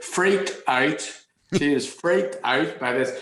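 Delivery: hammed-up Northern Irish accent. Retelling mother's reaction in Northern Irish accent. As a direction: lean into the Northern Irish vowels. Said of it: "your kids are sounding like this".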